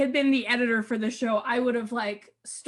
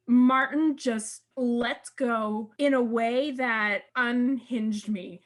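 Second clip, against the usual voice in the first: usual speaking voice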